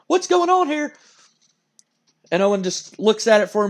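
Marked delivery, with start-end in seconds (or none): 0.1-0.9 high, urgent mock commentary; wrestling announcer. Announcer wondering about a possible attack, excited. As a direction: exclaim it high like a ringside commentator. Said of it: "Like, the announcer's like".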